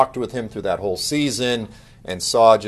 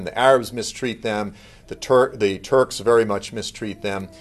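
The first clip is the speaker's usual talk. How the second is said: ordinary talk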